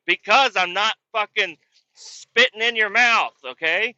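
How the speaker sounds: high, slow mock retort; exasperated retort. Acted quarrel over chewing.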